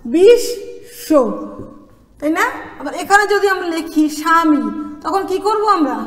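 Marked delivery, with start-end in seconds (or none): 0.0-1.1 "বিশ্ব" drawn out slowly as bish... sho; doubled consonant. Shown as a demonstration. Teacher showing how to say it twice, "বিশ্ব" slowly split as bish-sho. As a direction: break "বিশ্ব" in two, sounding "sh" in both halves. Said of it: "কিভাবে উচ্চারণ করব দেখো".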